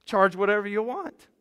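loud, high-pitched tossed-out figures; emphatic mock offer. Preacher voicing a tax scheme to the congregation, loud and high. Mimicking Rome pricing taxes.

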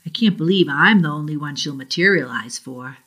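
plain reading voice

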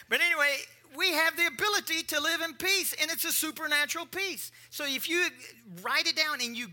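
loud, high sermon voice; preaching. Acted. Preacher closing a joke, loud preaching.

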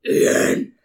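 loud, furious shout at her son; mother punishing her son, shouting; angry mom. Retold or imitated. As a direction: yell it like the furious parent. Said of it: "like, was like".

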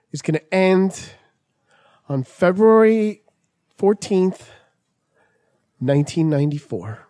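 halting, drawn-out proclamation; mock prophet. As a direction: leave portentous pauses between phrases. Performs doomsday premonition with long pauses.

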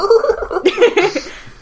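high squeaky giggle into the hand; girly giggle. Pretend. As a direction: giggle like a shy anime girl. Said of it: "giggle into your hand".

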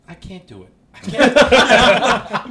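laughing. Retold or imitated. Comedian quoting Doug Ford's text, laughing. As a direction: quote the refusal while cracking up.

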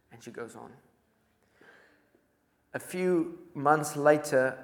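usual speaking voice

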